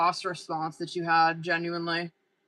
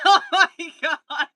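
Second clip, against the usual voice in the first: high laugh; 9 semitones higher, 9 dB louder than usual. Real, not acted. Reacting to her boss response with loud laughter. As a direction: burst of high laughter, then gasping.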